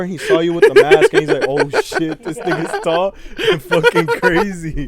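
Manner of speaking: high, laughing through the story. Real, not acted. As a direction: laugh while telling it. Laughing retelling of scared guy.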